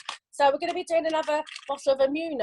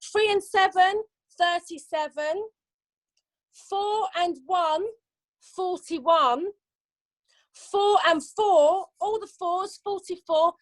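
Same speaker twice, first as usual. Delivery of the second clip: slow sing-song number calling; bingo caller; 3 semitones higher, slower than usual. Acted. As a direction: call each number drawn out.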